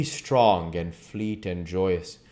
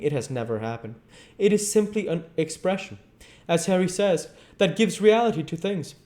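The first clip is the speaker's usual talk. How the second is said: put-on bored young dandy, pitched higher; airy Dorian; 8 semitones higher, 4 dB louder than usual. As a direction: brush off the tragedy lightly.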